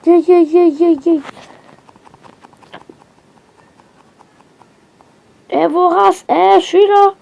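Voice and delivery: yeah lancés plus haut et fort; excité. Real, not acted. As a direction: encourager en pleine partie. Combat dans le jeu. (excitation de joueur en combat)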